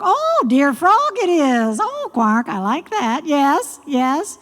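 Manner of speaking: high, gleeful frog voice; high-pitched pleased frog; delighted frog character. Acted. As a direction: play a flattered frog savouring the compliment. Frog pleased at being addressed.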